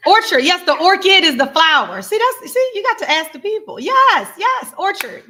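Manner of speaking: bubbling over with glee at the correction; thrilled yes-yes burst; excited. Real, not acted.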